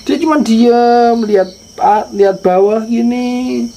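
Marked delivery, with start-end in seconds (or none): none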